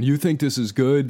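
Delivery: mock his sneer at the intro; mocking the program director. Program director scoffing at the podcast intro, mock sneer. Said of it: "and he's going".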